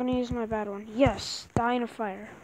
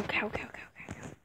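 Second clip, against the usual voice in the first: whispering; 3 semitones lower, 10 dB quieter than usual. Real, not acted. Sneaking up on a cow.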